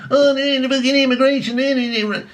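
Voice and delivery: high, whiny mock panic. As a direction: squeal it like a fussing doom-monger. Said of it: "People going on about the immigration thing".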